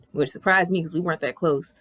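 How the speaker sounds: usual speaking voice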